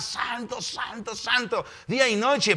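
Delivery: high, softened repeated acclamation; angels' ceaseless praise. Acted. Heavenly beings before the throne, praising without stopping. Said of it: "están sin parar".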